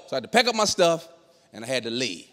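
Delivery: ordinary talk, nothing marked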